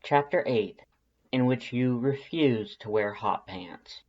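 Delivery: usual speaking voice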